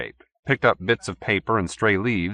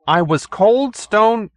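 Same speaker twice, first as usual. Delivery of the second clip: slow, high, affronted; indignant; 12 semitones higher, 6 dB louder, much slower than usual. A narrator's reading. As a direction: draw it out with wounded dignity. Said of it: "stood on his dignity".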